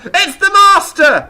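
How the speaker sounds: loud, high, drawn-out shout of realisation; imitated excited shout. Imitating mum shouting at the TV, excited shout. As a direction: shout it out as the penny drops. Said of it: "she literally shouted out".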